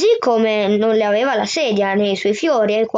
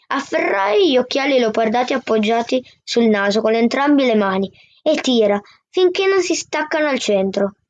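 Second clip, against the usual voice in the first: ordinary delivery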